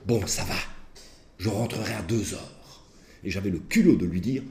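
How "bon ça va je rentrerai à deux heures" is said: soft, slow grudging giving in; mildly voiced defeated teenager; resigned teen. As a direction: son giving in to father, quiet and slow. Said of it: "ce pauvre gamin me disait".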